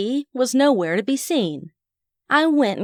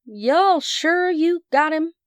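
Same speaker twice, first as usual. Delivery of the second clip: put-on Southern accent; 8 semitones higher, slower than usual. A narrator's reading. Narrator asks in Southern drawl.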